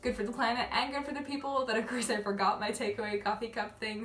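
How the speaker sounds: ordinary delivery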